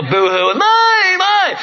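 mock toddler wail, very high; boo-hooing kid. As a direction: imitate a child's drawn-out, high-pitched tantrum. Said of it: "they get all upset".

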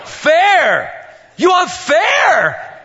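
mock-outraged father with a laugh; incredulous dad. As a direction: pounce on the word like a gleeful dad. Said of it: "and I go".

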